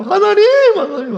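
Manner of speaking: a loud lament calling out to God; imitated wailing cry. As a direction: wail to God like a weeping mourner. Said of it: "통곡하면 소리가 들리잖아요".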